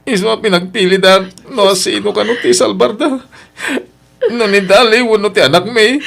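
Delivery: distraught. Acted. Husband telling his mother he chose the child, distraught and tearful. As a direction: force out the terrible choice. Must choose wife or child.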